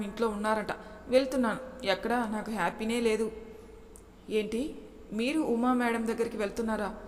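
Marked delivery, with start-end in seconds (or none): none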